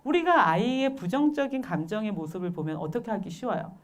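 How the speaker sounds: usual speaking voice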